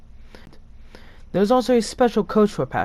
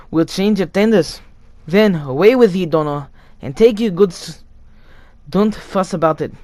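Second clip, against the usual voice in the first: higher, louder, urgent driver giving orders; urgent engine driver; 6 semitones higher, 9 dB louder than usual. Acted. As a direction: hurry them along in a raised, insistent voice.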